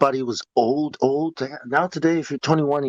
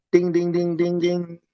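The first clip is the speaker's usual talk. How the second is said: higher, evenly repeated dings; imitated school bell; 5 semitones higher than usual. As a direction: chime like a bell on one pitch.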